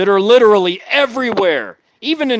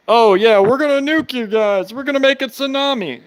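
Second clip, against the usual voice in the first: mocking; 4 semitones higher than usual. Mocking Russian media threats.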